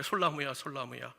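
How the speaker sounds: soft, low call by name, fading; gentle divine call. Retold or imitated. God calling the bowed musician, gently and quietly. God calls him tenderly in church.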